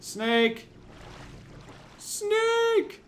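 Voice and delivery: very high squeaky snake cry; squealing snake alarm. Pretend. Player spotting a snake, squealing high. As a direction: squeal it like a cartoon critter.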